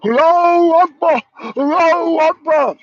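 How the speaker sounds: loud chanted yell, falling at the end; hammed-up shouting of the cry; mock battle cry. Streamer mocking fighters with a loud shouted chant. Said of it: "they're yelling".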